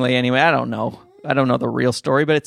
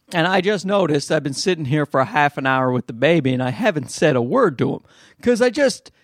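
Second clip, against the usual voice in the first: usual speaking voice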